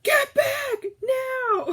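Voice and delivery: a stretched, laughing alarmed yell; husband's warning yell. Retold or imitated. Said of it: "I hear him".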